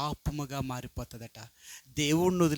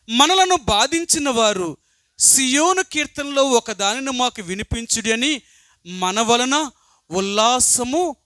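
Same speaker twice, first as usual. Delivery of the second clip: raised, ringing Bible reading from the pulpit; scripture reading; 6 semitones higher, 7 dB louder than usual. Acted. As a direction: proclaim the psalm to the congregation, louder. Reads the psalm aloud. (గట్టిగా వాక్యం చదవడం)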